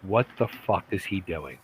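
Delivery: low, soft, baffled aside; saying to oneself. Retold or imitated. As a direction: mutter it to yourself in disbelief. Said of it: "kept saying to myself".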